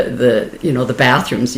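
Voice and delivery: ordinary delivery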